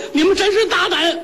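very high indignant outcry; outraged cry. Acted. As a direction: cry out in rage before collapsing. Poisoned official's last outburst.

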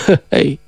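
a low, soft sinister heh-heh; soft low villain laugh; gloating chuckle. Acted. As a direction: chuckle darkly under the breath. Dragon king gloats at threat.

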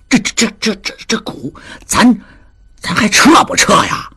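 气声般吞吞吐吐的结巴; 说不出口，声音越缩越小; 支支吾吾. A narrator's reading. 达庚支吾着问撤股. (达庚不好意思地支吾着问还撤不撤)

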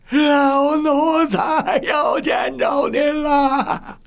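very high, long-drawn wailing howl. A narrator's reading. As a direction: wail it out slowly as an old servant to his master. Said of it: "嚎叫着说". (拖长声音高声嚎叫)

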